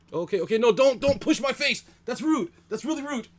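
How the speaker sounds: startled protest. Real, not acted. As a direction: squeal in alarm as the dog shoves your face. Dog pushes her face.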